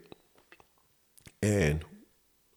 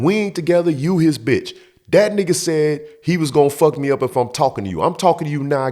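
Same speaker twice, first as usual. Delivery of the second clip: angry arguing; 6 semitones higher than usual. Retold or imitated. Acted quarrel at the door.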